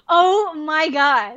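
mock appalled reaction; aghast disbelief. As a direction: stretch each word in horror. Aghast at boss's cancelled meeting.

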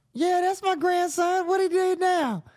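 a grandma who expects trouble from her grandson; old grandma voice. Retold or imitated. Grandmother answers the cop's call, weary high old-lady voice.

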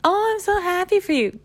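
high, gushing fake delight; fake happy. Mimicking haters with fake energy, falsely gushing. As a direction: put on phony congratulations way up high. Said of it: "with some fake energy".

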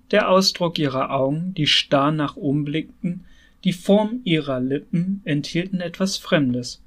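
ordinary delivery, nothing marked

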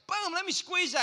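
lightly acted gung-ho doctor; eager doctor. Acting the doctor squeezing.

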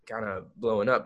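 ordinary delivery

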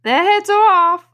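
very high, loud shouted report; shouted reply. A narrator's reading. Soldiers answering the queen, shouting high. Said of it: "the soldiers shouted in reply".

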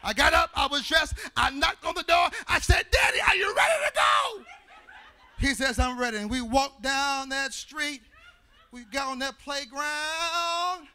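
loud, rising preacher excitement; gleeful shouting retelling; excited storytelling. Acted. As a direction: tell it like the best morning of your life.

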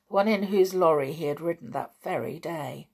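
ordinary delivery, nothing marked

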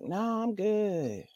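quiet, slow "no, I'm good"; soft polite refusal. Retold or imitated. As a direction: decline it softly and slowly. Declining the nudist host.

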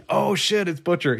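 high excited recognition; surprised. Retold or imitated. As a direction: blurt it out in sudden surprise. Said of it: "I was like".

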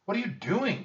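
high, loud, alarmed shout. A narrator's reading. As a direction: yell the question in disbelief. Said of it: "Jack shouted".

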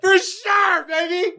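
high excited whoop; excited squeal. Real, not acted. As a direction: shout it high and giddy. Excited drunk exclamation.

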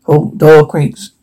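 plain reading voice

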